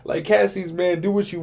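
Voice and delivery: amused, still laughing at the scene; laughing at his own movie retelling. Real, not acted.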